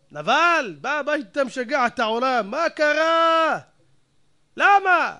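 loud, high, outraged rebuke; indignant outburst. Acted. Household rails at the hot-tempered man, indignant outburst. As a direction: cry out with stretched, exasperated questions.

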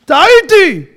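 shouted high and loud; loud admission. Shown as a demonstration. Preacher shouting the word of regret to the crowd. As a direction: cry it out as a real confession. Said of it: "תשמע".

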